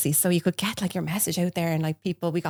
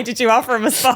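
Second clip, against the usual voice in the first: teasing quote; 6 semitones higher, 5 dB louder than usual. Retold or imitated. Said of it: "I was just gonna say".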